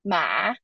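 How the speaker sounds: MA long, dipping low and rising; third tone. Shown as a demonstration. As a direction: sink MA low, then lift it. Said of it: "it's the third tone".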